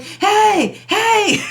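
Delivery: high, loud playful calling out; mock honking driver. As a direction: call out to her from the car behind, teasing. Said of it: "He's like".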